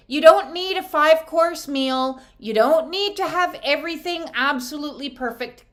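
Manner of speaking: ordinary talk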